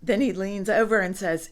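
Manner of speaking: plain reading voice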